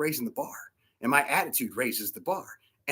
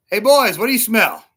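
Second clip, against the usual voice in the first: loud, high dad call; 6 semitones higher, 9 dB louder than usual. Retold or imitated. Dad asking his boys in the car, calling out.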